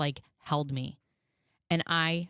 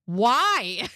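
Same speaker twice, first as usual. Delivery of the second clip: loud, high, incredulous mock question; baffled mock reply; 7 semitones higher, 8 dB louder than usual. Puzzled doctor answering a gushing mother, mock bafflement. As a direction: throw it out in hammed-up bafflement.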